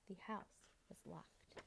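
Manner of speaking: slow hushed whisper. A narrator's reading. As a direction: let the locked door land quietly. Suspense, narrator drops.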